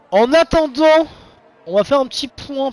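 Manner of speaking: high, loud mock announcer voice; odd put-on voice. Streamer announcing the halftime update to viewers, hammed-up high announcer voice. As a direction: announce the break like a hammed-up host. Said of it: "Pourquoi je parle comme ça?".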